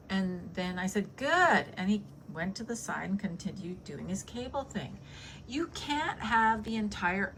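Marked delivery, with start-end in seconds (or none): 1.2-1.7 a high, louder snapped "Good"; curt retort. Retold or imitated. Telling off the gym guy, sharp high curt retort. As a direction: slam the door on the argument.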